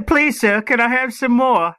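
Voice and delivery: host jokingly auditions for Oliver Twist, mock cockney orphan plea. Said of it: "an extra for Oliver Twist".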